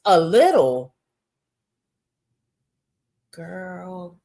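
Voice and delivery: loud disbelieving repeat; mock-shocked echo; incredulous scoff. Real, not acted. Scoffing at her self-description.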